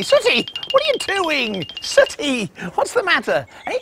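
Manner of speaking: ordinary delivery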